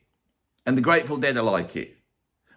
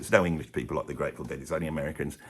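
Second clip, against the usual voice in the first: mock American fan accent; 7 semitones lower, 3 dB quieter than usual. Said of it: "I'm doing it in an English accent".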